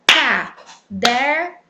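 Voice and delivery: "caderno" split slowly into CA, DER, NO; syllable by syllable. Shown as a demonstration. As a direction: say "caderno" in three separate beats, firmly. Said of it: "Separando as sílabas". ("caderno" separado devagar, sílaba por sílaba: CA, DER, NO)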